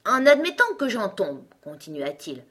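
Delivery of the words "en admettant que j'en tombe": higher, louder, grandly pompous; resume the speech with great importance; pompous egg. A narrator's reading. (gros coco majestueux)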